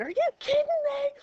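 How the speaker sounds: high, incredulous mock outburst; mock exasperation. Teacher imitating herself slamming students, high mock exasperation. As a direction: pretend to be a fed-up teacher, shrieking high. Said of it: "I'm not trying to slam you by going".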